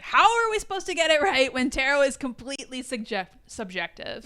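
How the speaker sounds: high, loud mock protest, easing off at the end; exasperated Agatha. Retelling Agatha's objection to tarot, exasperated. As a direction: imitate an exasperated complaint, starting high and loud.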